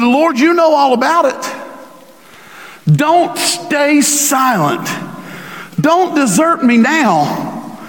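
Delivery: slow, plaintive reading of the prayer; pleading psalm reading. Real, not acted. Pastor reading the psalm aloud, slow and pleading. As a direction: read it as a slow plea to God.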